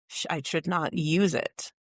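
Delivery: ordinary talk, nothing marked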